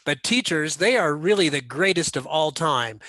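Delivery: normal talking voice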